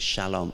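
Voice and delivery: Shalom said softly and lingeringly, sh-a-LOM; lovingly savouring the Hebrew word Shalom; savoured shalom. Shown as a demonstration. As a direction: let Shalom roll out gently with a soft sh. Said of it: "It sounds lovely".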